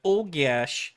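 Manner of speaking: hammed-up Midwestern Wisconsin voice; Wisconsin accent. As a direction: put on a Wisconsinite fretting loudly. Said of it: "you're from Wisconsin and you're like".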